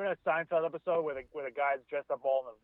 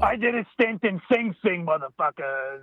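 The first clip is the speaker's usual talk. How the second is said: tough guy voice; 2 semitones higher, 5 dB louder than usual. Pretend. Voicing Tony as ex-con.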